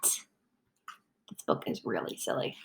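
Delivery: slow whispered aside; whispering. Pretend. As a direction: whisper it to the kids.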